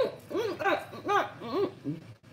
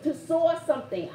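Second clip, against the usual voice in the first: ordinary talk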